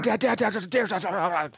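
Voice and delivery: high, rushed excited calling; freaking out. Retold or imitated. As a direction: panicky kid on the walkie-talkie. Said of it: "freaking out".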